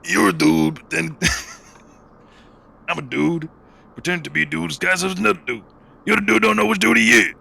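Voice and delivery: high, loud movie-quote impression; Robert Downey Junior. Host quoting a movie to his friend, loud high impression. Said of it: "Robert Downey Junior in that one movie".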